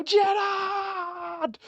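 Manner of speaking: high, ringing goal celebration yell; celebration shout. Retold or imitated. Fan wheeling away after a belter, yelling the name. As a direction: shout it like you just scored from twenty-five yards. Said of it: "run off and go".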